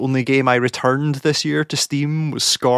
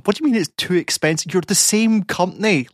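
retold exasperated protest, high and insistent; incredulous; 7 semitones higher than usual. Viewer challenging Twitch's excuse, incredulous. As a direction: pitch jumps up in disbelief. Mock argument with the company.

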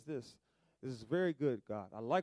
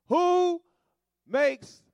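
booming repeat; 12 semitones higher, 11 dB louder than usual. Acted. Repeating God's question emphatically.

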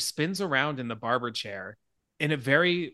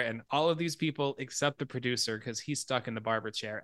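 usual speaking voice

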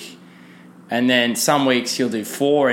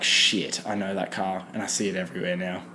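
much lower, softer, quick muttered realisation; a listener suddenly recognising the car, lower and softer; dawning realisation; 5 semitones lower, 7 dB quieter than usual. Retold or imitated. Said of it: "you're gonna be like".

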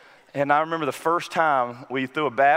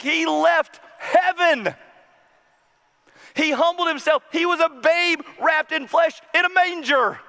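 high, loud, ringing gospel proclamation; full-force preaching; 10 semitones higher, 3 dB louder than usual. Acted. A pastor proclaiming Christ to the congregation at full force. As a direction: lift the room with the story of Christ.

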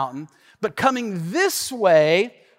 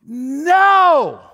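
defiant no; 8 semitones higher, 8 dB louder than usual. Retold or imitated. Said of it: "And I said".

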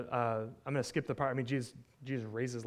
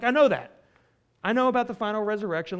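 louder, higher frustrated comeback; exasperated retort; 6 semitones higher, 10 dB louder than usual. Acted. Preacher as grieving Martha answering Jesus, exasperated. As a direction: cut off the empty comfort, loud and impatient. Martha snaps back at empty comfort.

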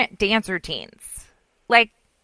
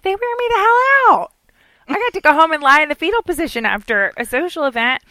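exasperated; 7 semitones higher, 4 dB louder than usual. Retold or imitated. Exasperated answer to counselor.

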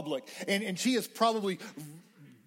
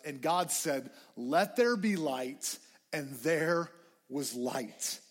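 slow solemn reading; 5 semitones lower, slower than usual. Acted. Genesis read slowly and low.

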